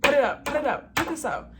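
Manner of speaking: high, loud repeated command; bossy order. Retold or imitated. Flight attendant ordering a passenger to close the tray, loud and bossy. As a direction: snap the order at the passenger like a sergeant. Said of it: "She was just like".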